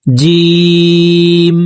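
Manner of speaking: জিম stretched very long in a steady sung tone; three-alif long jim. Shown as a demonstration. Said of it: "তিন আলিফ টেনে পড়ব".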